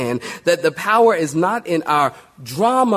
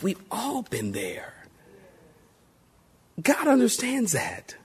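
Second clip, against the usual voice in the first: near-whispered, softer aside; hushed reassurance; 3 semitones higher, 8 dB quieter than usual. Real, not acted. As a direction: confide it gently to the congregation. Hushed empathetic aside to congregation.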